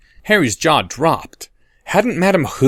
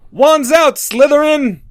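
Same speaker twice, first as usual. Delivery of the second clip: much higher, loud rallying shout; battle cry; 5 dB louder, slower than usual. Acted. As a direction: bellow it as a war cry. Slytherins answering the challenge.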